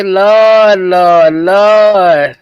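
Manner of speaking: loud pleading Lord cry; a loud, drawn-out plea to the Lord; Messy Michon. Retold or imitated. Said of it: "what's that Messy Michon say?".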